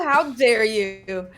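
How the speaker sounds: hammed-up theatrical indignation; drawn-out mock outrage. Co-caster feigns outrage at the player's name, slow mock offence. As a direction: stretch it out slowly in fake offence.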